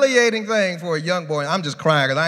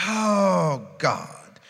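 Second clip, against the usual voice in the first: normal talking voice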